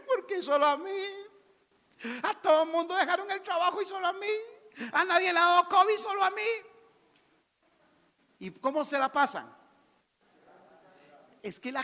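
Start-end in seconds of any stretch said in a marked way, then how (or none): 0.1-6.5 high, whiny mock sob; mock whining believer. Preacher mocking a churchgoer complaining to God, whiny mock sob. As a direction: whine like a self-pitying believer.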